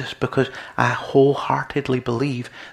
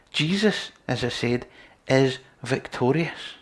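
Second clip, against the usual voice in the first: normal talking voice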